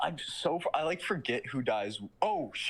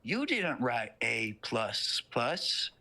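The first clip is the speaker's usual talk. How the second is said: slow, drawn-out Southern menace; Southern devil; much slower than usual. Retold or imitated. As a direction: drag each word out, coolly accusing. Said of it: "And he goes".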